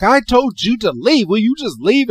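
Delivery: hammed-up annoyed creature; exasperated. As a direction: tell him off, out of patience. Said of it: "and Pikachu's like".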